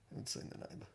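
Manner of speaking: very soft, voiceless echo; whispered repeat. Real, not acted. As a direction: repeat it under your breath. Repeats it under the breath.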